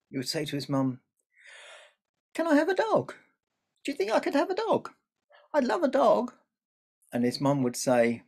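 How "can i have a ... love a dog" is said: put-on high, pleading little boy voice; eager child. A narrator's reading. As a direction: beg like an excited small boy. Child begging mum for dog.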